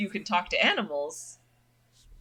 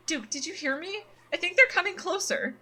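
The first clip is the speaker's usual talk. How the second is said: worried Stella; 9 semitones higher than usual. Acted. Voices Stella in game.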